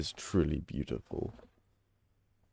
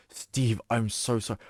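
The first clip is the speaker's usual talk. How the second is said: ordinary delivery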